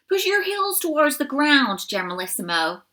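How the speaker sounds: a drill instructor coaching a stretch; bossy coach. A narrator's reading. American doe instructs the elderly leader, bossily. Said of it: "said Laser".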